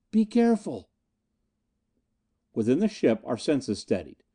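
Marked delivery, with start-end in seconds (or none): 0.1-0.9 high-pitched woman's warning cry; Anita calling after them. A narrator's reading. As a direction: call a worried warning from a distance. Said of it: "Anita's voice followed us".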